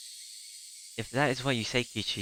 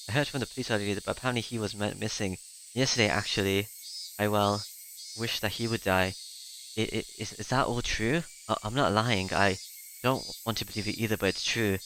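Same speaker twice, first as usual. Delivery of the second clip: usual speaking voice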